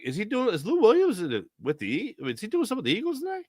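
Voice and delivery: high, quick bewildered questions; retold surprise. Host retelling his reaction to the press clip, high puzzled surprise. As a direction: act baffled at the lookalike. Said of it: "and I'm like".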